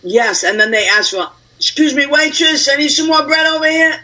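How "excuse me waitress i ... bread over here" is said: mock diner calling out, higher-pitched; lightly acted call across a restaurant; calling the waitress.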